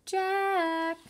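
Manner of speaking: high, sung-out calling from far off; distant call. A narrator's reading. Mom calls the kids home from a distance. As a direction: call them in for dinner from the house. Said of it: "she called".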